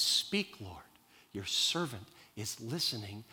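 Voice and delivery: soft, half-whispered "Speak" then a quiet, reverent reply; hushed answer. Acted. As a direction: whisper it like a child answering God in the dark. Boy answering God at night.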